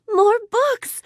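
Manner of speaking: quick gasp, then high-pitched exclamation; delighted surprise. Acted. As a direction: gasp with joy at an unexpected gift. Character is handed more books.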